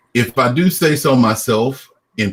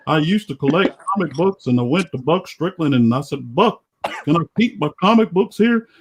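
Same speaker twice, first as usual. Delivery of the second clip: higher, twangy cartoon dad; Hank Hill; 6 semitones higher than usual. Guest tells of lost comics in character, Hank Hill voice. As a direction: grumble along as the Southern cartoon dad. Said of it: "do the Hank Hill impression".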